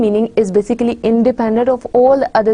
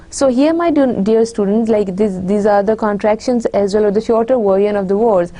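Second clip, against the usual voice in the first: normal talking voice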